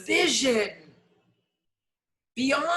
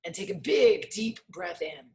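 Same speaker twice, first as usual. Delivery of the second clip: low, unhurried meditation voice with long pauses; guided meditation; 6 semitones lower than usual. Acted. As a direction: guide the room gently into the body. Leads a breathing meditation.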